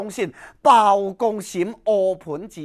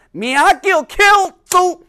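booming reveal; 10 semitones higher, 10 dB louder than usual. Acted. Bao Gong names the ghost.

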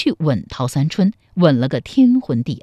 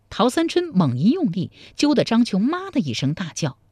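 usual speaking voice

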